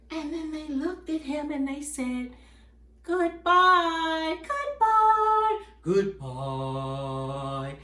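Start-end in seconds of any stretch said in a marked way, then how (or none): 3.0-5.6 singsong, drawn-out parting; friends' farewell. Acted. Friends leaving the rooster behind, singsong farewell. 5.8-7.8 very low, drawn-out farewell; deep-voiced friend. Last friend turning away from rooster, deep goodbye.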